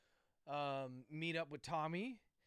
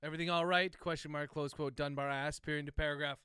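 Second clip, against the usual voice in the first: dictating; 4 dB louder than usual. Real, not acted. Author dictating novel chapter.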